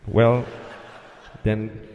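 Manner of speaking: halting, reluctant clearance with long gaps; hesitant controller. Retold or imitated. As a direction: drag it out low and unsure. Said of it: "That's how he said it".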